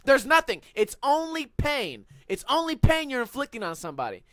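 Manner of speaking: normal talking voice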